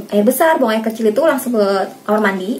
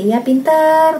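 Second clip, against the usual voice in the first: put-on praising child; 6 semitones higher, much slower than usual. Shown as a demonstration. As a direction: cheer the child on for putting a leg in.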